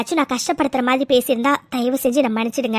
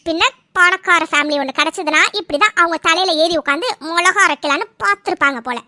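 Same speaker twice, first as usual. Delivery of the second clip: usual speaking voice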